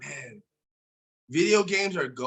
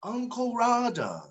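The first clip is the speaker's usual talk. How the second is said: Uncle Roger; 8 semitones higher, slower than usual. Acted. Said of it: "my best Uncle Roger impression".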